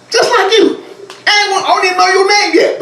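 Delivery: high, excited and laughing. Acted. Preacher to congregation, high laughing excitement. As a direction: lift up with delight at the crowd.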